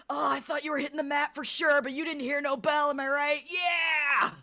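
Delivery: loud, high, rowdy congratulation; super excited. Acted. Alivire puts an arm around Shriek, super excited. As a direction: whoop it up, building to a long yell. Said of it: "Super excited over that fight".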